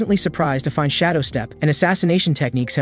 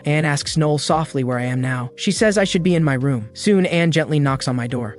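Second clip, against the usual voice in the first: usual speaking voice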